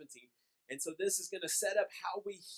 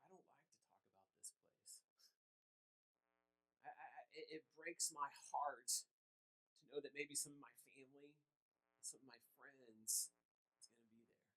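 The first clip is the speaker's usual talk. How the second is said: tearful quote; 4 semitones lower, 16 dB quieter than usual. Retold or imitated. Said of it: "she started crying. Just weeping".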